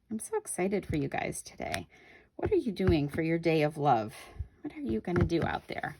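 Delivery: usual speaking voice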